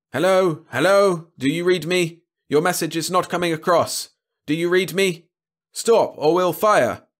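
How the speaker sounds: high, loud calling into a radio; urgent radio hail. A narrator's reading. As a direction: call out insistently, building to a threat. Patrol hailing fleeing ship by radio.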